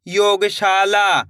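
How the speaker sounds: "योगशाला" stretched very slowly, every syllable drawn out; slow blended word. Shown as a demonstration. Teacher blending the word for pupils, drawn out very slowly. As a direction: pull the word apart as you blend it. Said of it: "कैसे पढ़ेंगे?".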